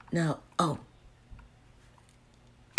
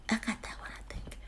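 whispering; 2 semitones higher, 14 dB quieter, much faster than usual. Real, not acted. Counting candles in cart.

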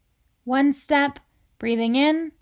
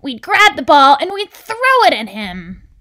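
loud, higher, eager scheming; fired-up storybook character egging a friend on; excited; 4 semitones higher, 10 dB louder than usual. A narrator's reading. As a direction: build excitedly to a loud finish. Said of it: "said Anger excitedly".

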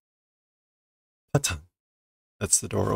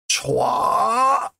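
high, sharp kung-fu yell, louder; martial arts cry; 12 semitones higher, 3 dB louder than usual. Acted. Yotsuba fighting back at the monster, sharp martial arts cry. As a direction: shout it like a karate strike. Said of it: "now she's gonna do some martial arts".

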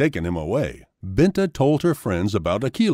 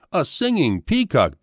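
higher-pitched exclamation, no real shout; mild storybook scream; 6 semitones higher than usual. A narrator's reading. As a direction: exclaim in raised pitch, understated. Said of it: "Binta screamed".

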